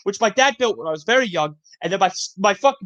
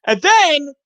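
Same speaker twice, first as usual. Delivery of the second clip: loud, high, drawn-out "and then"; animated; 6 semitones higher, 6 dB louder than usual. Real, not acted. Storyteller starting the next tale, over-animated. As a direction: launch the next bit with big animated energy. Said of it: "[name]'s just so animated".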